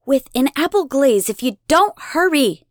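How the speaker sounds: put-on bossy girl voice; teasing big sister. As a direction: scare him into running. Big sister teasing little brother.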